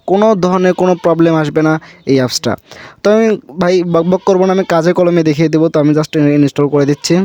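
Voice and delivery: usual speaking voice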